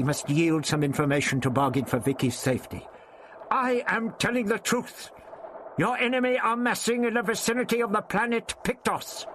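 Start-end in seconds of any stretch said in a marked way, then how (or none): 3.5-9.3 put-on high, firm old man insisting; old Doctor, firm. A narrator's reading. The Doctor bargains with the voice, firm. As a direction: stand your ground, lay out the facts.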